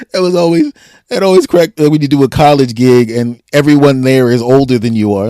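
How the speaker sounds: ordinary talk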